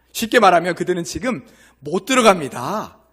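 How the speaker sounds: ordinary talk, nothing marked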